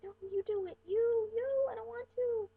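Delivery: put-on high, loud childish protest; acted tantrum, pitch shooting up; whiny refusal. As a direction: whine it like a kid refusing mom.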